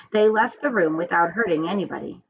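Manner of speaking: ordinary delivery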